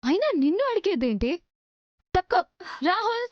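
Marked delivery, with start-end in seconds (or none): 2.9-3.3 raised call, pitched up. Acted. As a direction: call his name across the house. Mistress calls out for Rahul.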